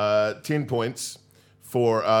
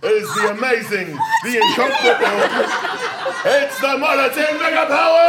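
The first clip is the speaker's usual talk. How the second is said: high, loud wrestling introduction; big arena announcer voice; ring announcer; 13 semitones higher, 7 dB louder than usual. Acted. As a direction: hype the crowd, building to a shouted team name. Plays ring announcer introducing the teams.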